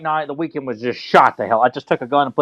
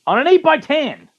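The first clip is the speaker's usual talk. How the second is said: much higher, exasperated EIGHT-BY-TEN; incredulous repeat; 12 semitones higher than usual. Real, not acted. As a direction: push "eight-by-ten" high in disbelief. Repeats it in disbelief.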